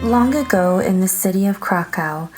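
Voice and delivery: ordinary delivery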